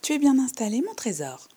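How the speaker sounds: put-on high, loud old woman's rasping question; rattle-voiced old lady. Old lady asks the girl if she's comfy, rattling. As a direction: ask it like a creaky old rattle. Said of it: "de sa voix de crécelle".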